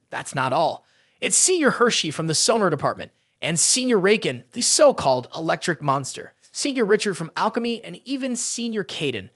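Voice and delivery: ordinary delivery, nothing marked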